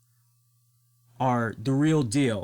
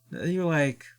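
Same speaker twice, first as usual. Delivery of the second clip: normal talking voice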